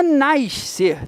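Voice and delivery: NASCER slowly as "naish-ser"; wrong carioca nascer. Shown as a demonstration. Teacher correcting students, slow exaggerated "naish-ser" for NASCER. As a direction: stretch "nascer" with an extra hushing "ish". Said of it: "Não é nascer".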